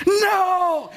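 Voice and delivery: high, drawn-out mock wail of "no"; frustrated cry. Driver hearing static swallow the touchdown, mock frustrated cry. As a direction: wail in comic exasperation.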